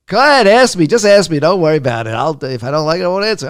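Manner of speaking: loud, high imitation of a coach; impatient demand. Coach telling interviewer to ask anyway, loudly impatient. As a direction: burst in over him, then ease off slightly.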